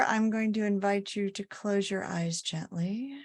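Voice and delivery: calm body-scan guidance with long pauses; unhurried, evenly pitched meditation leader's voice; guided meditation. Acted. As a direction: lead listeners gently through the body, pausing between phrases.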